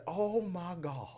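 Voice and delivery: slow, hushed mock disbelief; breathy whispered shock; attorney impression. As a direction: whisper it slowly, as if appalled. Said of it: "let me do my lead".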